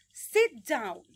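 firm, loud "Sit down"; exaggerated classroom command. Shown as a demonstration. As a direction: call it out to the class. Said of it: "lo exagerada que soy".

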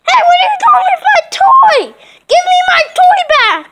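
angry doll. Pretend. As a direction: shout it like a robbed child. Dolls quarrel over a toy.

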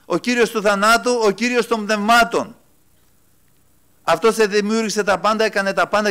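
high, loud, ringing sermon voice; full-throated pulpit delivery; fiery preaching. Acted. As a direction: preach it out to the congregation.

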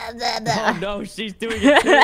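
loud, high, laughing cry. Real, not acted. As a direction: laugh out a shocked protest. Laughing alarm at groaning.

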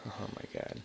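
breathless startled whisper; whispered fright. Real, not acted. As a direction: whisper it after a jump scare. Startled by enemy.

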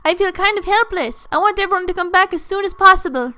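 put-on cartoon presenter; chatty sprite plugging a program; animated game-character read; TV-host sprite. Voices TV sprite's dialogue.

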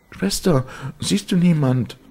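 very high, loud, fearful question; high-pitched anxious calling; trembling woman's cry. A narrator's reading. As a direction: cry out to a sister in a high, desperate voice. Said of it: "schrie die Zitternde".

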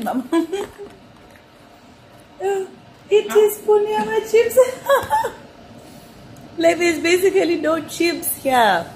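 high, loud, laughing through words; cracking up at the tiny portion. Real, not acted.

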